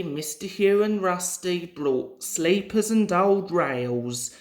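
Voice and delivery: plain reading voice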